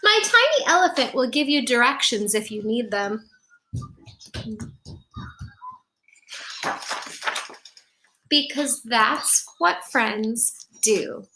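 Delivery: put-on bright storybook narration for kids; gentle picture-book reading voice; reading to children. A narrator's reading. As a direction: read it like storytime on the rug. Reads picture book aloud.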